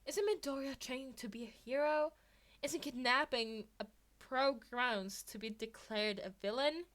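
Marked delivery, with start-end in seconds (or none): none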